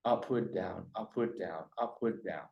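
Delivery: quiet see-saw on "Upward" and "down"; up and down inflection. Shown as a demonstration. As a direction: drop the pitch on each "down". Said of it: "each sentence goes up and down".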